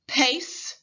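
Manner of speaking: teacher stretching the final s; final hiss. Shown as a demonstration. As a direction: hiss out the end of "Pace,". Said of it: "Repeat after me".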